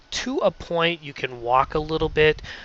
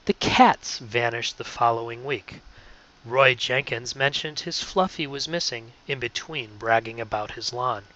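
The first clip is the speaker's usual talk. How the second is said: read slower; 3 semitones lower than usual. Shown as a demonstration. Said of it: "I would read it more like this".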